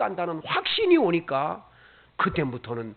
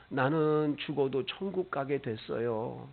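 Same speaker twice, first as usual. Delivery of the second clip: put-on soft child's words; 6 semitones lower, 7 dB quieter than usual. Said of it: "우는 거예요".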